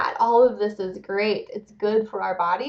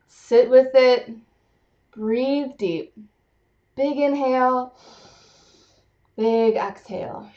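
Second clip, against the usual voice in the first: slow, calm breathing cues; guided breathing; slower than usual. Acted. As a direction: guide them to breathe slowly. Guides breathing after exercise.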